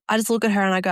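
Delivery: usual speaking voice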